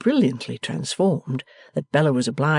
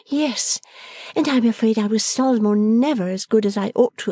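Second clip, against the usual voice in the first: lightly voiced young woman, higher; a lively daughter giving orders fondly; bright young daughter; 8 semitones higher than usual. A narrator's reading. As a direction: Bella coaxing her father, lightly voiced, higher. Bella confessing fondly.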